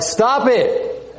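a sudden high-pitched "Stop it!", pitch jumping far up; family yelling stop. Retold or imitated. The family snapping at the Bible-reading sister, high mock yell. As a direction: snap it high and sharp like an exasperated relative.

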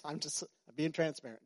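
ordinary talk

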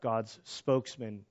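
normal talking voice

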